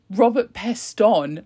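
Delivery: Robert Peston said slowly, stretched out syllable by syllable; slow mock fumble of Robert Peston; mispronounced name. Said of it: "she pronounced it like that".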